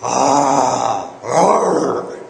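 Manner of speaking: loud roar breaking upward; monster roar. Shown as a demonstration. Yodel teacher demonstrating a monster, cracking roar. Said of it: "tried to sound like a monster".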